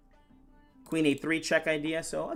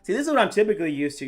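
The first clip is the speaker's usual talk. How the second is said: fast, louder eager chatter; excited; 4 semitones higher, 6 dB louder, faster than usual. Real, not acted. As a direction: rattle it off with glee. Excited when winning on time.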